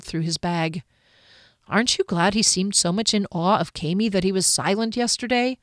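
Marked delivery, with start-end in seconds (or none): none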